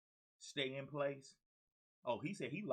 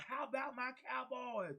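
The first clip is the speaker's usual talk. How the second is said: fan cheer; 12 semitones higher than usual. Real, not acted. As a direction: cheer it like a die-hard fan.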